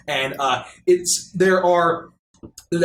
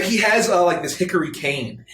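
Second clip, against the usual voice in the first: ordinary talk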